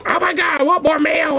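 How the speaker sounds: mocking the customer. Sandwich worker mimics the rude lady demanding mayo, high whiny mockery. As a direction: squeal it like an entitled customer complaining. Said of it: "who would berate all of us, being like".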